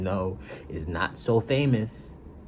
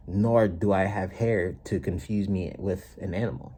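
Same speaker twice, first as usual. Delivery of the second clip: ordinary delivery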